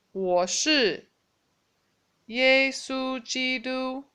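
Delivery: slow, phrase by phrase, each chunk set apart; slow phone greeting demo. Shown as a demonstration. Teacher showing students how to open a phone call, slowly. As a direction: spell out the greeting slowly for learners. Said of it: "what you can say when you are making your phone call is".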